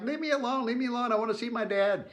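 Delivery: put-on high, piping child voice brushing off help; dazed little boy. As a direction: squeak it out like a stunned six-year-old. Retold child pushing stranger away.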